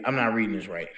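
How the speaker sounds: ordinary talk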